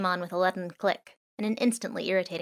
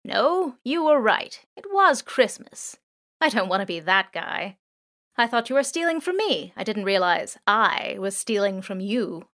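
drone, contrite; 2 semitones higher, 4 dB louder than usual. Acted. Drone to the thieves, admitting it was wrong. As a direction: own up gently, slowing on Christmas.

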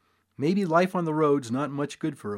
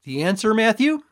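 loud, higher showy question; grand cue; 8 semitones higher, 7 dB louder than usual. A narrator's reading. Father cues his son before the crowd, loud and grand. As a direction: cue the boy with a flourish.

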